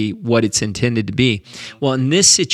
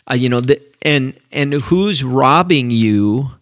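ordinary talk, nothing marked

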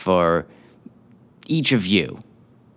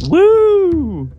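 excited cheer; 8 dB louder than usual. Acted. Host cheers at crowd.

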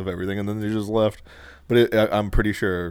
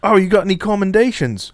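put-on high, loud Aussie kid asking; put-on Australian voice, pitched up; mock Australian accent; 12 semitones higher, 7 dB louder than usual. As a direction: ask in a hammed-up Australian accent. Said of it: "like, two Australian kids".

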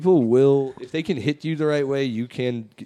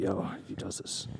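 unvoiced whisper to a friend; whispered aside; 4 semitones lower, 14 dB quieter than usual. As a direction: whisper it under your breath. Muttered aside in story.

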